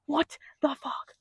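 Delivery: slow stunned whisper of disbelief; whispered what the fuck. Retold or imitated. Shocked narrator whispering to herself. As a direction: breathe it out, frozen in shock.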